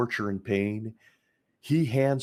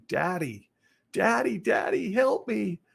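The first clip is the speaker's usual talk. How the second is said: put-on high, shouted child's cry for her daddy; begging child; 11 semitones higher than usual. Child pleading with her father to save her, high desperate shout. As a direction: cry out like a terrified little girl.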